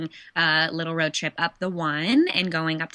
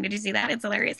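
ordinary delivery

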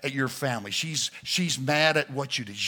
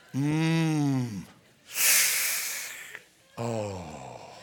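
sympathetic hum; 3 semitones lower than usual. Shown as a demonstration. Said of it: "Here they are".

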